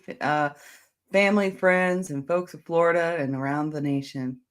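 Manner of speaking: normal talking voice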